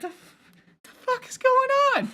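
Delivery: faltering start, then very high incredulity; stammer, then pitch it way up, dumbfounded; stunned bewilderment. Retold or imitated. Kicked man watches attacker run off, high-pitched bewilderment.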